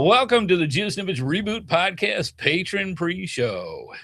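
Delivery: slow, grand show intro; podcast announcer. Acted. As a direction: announce it like a host. Opens the show as host.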